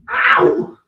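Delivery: sharp high yelp of pain; hit hard crying out. Real, not acted. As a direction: cry out, elbow just whacked. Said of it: "That hurt".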